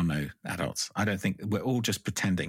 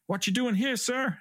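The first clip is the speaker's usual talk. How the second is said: higher, mock-stern cop asking questions; imitating a cop; 14 semitones higher, slower than usual. Cop questions a man walking at night, imitated higher. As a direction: play the suspicious patrol officer. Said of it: "And they're like".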